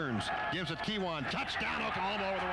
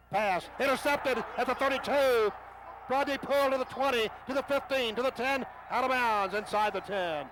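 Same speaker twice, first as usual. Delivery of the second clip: rising, loud play-by-play surge; excited call; 8 semitones higher, 4 dB louder than usual. Real, not acted. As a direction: call the runback as it happens, building. Interception run call.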